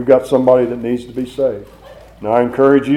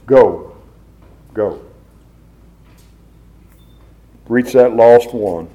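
preaching. Acted. Preacher pleading to reach people.

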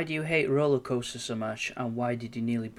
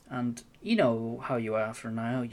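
ordinary delivery

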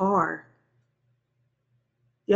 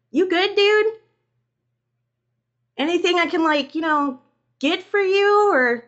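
high, loud tentative offer; awkwardly concerned roommate; 11 semitones higher, 6 dB louder than usual. A narrator's reading. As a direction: offer help through a door. Roommate calls through bathroom door.

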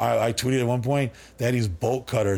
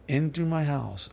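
usual speaking voice

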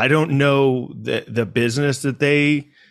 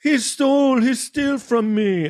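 much higher put-on voice, slow on the second part; imitating the woman; 13 semitones higher, slower than usual. Narrator mimics the woman's vague accusation, much higher, slow mock voice. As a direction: drag out the grievance up high. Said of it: "And she'll be like".

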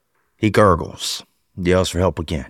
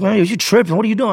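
incredulous friend; 11 semitones higher than usual. Retold or imitated. Friend scolds him.

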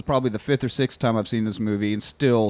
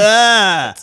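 mock shout; 7 semitones higher, 9 dB louder than usual. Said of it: "shot my gun in the air and went".

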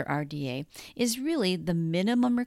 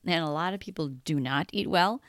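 ordinary talk

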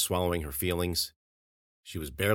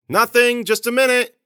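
much higher and louder call back; 13 dB louder than usual. Acted. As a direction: shout back down the hall. Ariel calls back to Grossman.

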